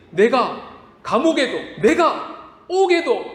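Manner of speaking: high, slow boastful Peter; Peter's boast. Acted. Pastor voices Peter boasting to Jesus, high and slow. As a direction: draw out the proud vow.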